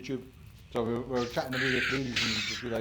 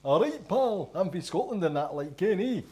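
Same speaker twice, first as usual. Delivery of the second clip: hammed-up Scottish bloke; over-the-top Scottish accent; 8 semitones higher, 3 dB louder than usual. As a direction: lay the Scottish accent on thick. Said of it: "try and do a really Scottish accent".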